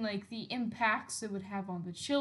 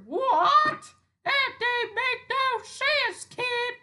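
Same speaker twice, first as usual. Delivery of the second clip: much higher, louder, slow, robotic; 14 semitones higher, 11 dB louder, much slower than usual. Retold or imitated. Robot scolding the kid, high, loud and slow. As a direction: play a dumb robot answering.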